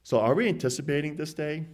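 ordinary talk, nothing marked

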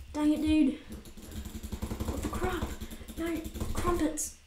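high-pitched, badly faked Australian rage; mock Australian accent. Shown as a demonstration. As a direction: do a shaky-at-best Australian accent when raging. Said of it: "an Australian accent when I'm getting, like, rage".

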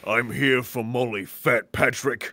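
low, soft, brooding threat; menacing hero. Acted. Hero come for Molly, low menacing voice. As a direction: drop the pitch and draw out the name.

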